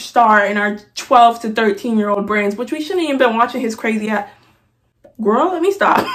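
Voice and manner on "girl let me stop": ordinary talk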